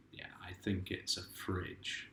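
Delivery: soft hushed whisper, barely voiced; slow, conspiratorial whisper. Real, not acted. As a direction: lean in and whisper it like a secret. Confiding quiet aside to child.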